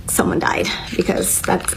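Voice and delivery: whispered "someone died", barely voiced; heartbroken whisper. Real, not acted. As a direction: let it fall to a whisper of grief. Grief over the breakup.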